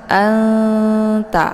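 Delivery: Anta with a faint held nasal n sliding into ta; ikhfa on anta. Shown as a demonstration. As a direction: blur the n of Anta into ta. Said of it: "nunnya dibaca samar-samar".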